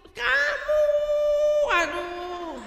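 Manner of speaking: high, sung-out mock complaint; hammed-up sing-song scolding of a child; sing-song mock nagging. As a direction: sing the nag like a whining mother.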